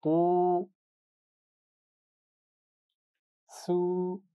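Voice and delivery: तू, सू held long, सू thick and heavy; thick drawn-out u. Shown as a demonstration. Teacher shows the heavy सू to students: long thick u. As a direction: stretch the u, make सू heavy. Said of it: "सौद की आवाज मोटी होगी".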